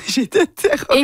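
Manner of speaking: a playful mock-frightened squeal with laughter; laughing mock terror. Real, not acted. Guest laughing at her own stage fright, high mock-terrified. Recalls fear, then laughs.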